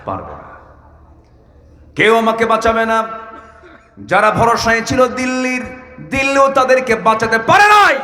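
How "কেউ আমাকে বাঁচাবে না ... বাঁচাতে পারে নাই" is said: high, loud sermon chant; full-force preaching. Real, not acted. Preacher rousing the crowd about Delhi's failed protection, impassioned preaching. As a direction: preach it high, building to a shout.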